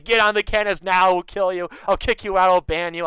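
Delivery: half-strength mock yell; retold screaming. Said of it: "He's, like, screaming down saying".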